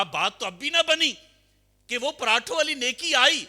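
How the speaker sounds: high, loud sermon cry; preaching at full force. Acted. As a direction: ring it out to the crowd. Sermon climax.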